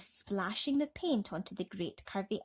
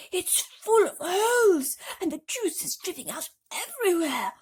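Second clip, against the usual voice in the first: put-on alarmed old grasshopper; 11 semitones higher, 8 dB louder than usual. A narrator's reading. Grasshopper warning the travellers, high alarmed voice. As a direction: panic rising in an old creature voice.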